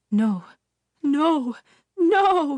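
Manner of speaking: building from plain to high, loud scream. Acted. Father screams in panic at the vanished witch. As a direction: rise from dread into a full scream. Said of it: "he screamed".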